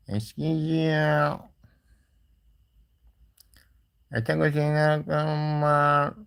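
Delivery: high, slow peddler's sing-song call; melodic street vendor shout. Pretend. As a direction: cry your wares down the street like a junk dealer. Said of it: "hurda toplamaya giderim". (yüksek sesle, ağır ağır eskici çağrısı)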